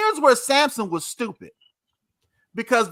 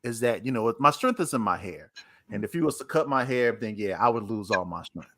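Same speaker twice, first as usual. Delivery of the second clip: much lower, deep laid-back teasing; Samson's voice; 12 semitones lower, 5 dB quieter than usual. Retold or imitated. Samson brushing off Delilah, deep lazy tease. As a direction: drop way down and brush her off lazily.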